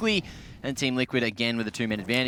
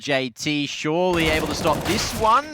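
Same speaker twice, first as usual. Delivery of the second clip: excited esports call, building higher and louder; live play-by-play; 5 semitones higher, 6 dB louder than usual. Real, not acted. Caster calling whether J T can stop this one, rising play-by-play. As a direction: build steadily to a loud, high peak.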